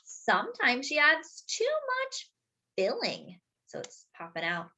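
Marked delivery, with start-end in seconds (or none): none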